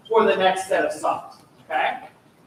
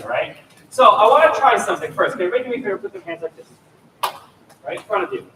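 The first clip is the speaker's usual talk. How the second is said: ordinary delivery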